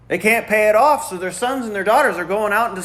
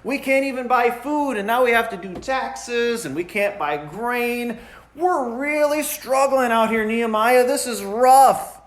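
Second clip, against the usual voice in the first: higher whining voice of a struggling taxpayer; whiny complaint; 6 semitones higher than usual. Retold or imitated. Taxpayers crying to Nehemiah about taxes, higher mock whine. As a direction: moan about taxes like you're barely getting by. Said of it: "They're crying about the taxes".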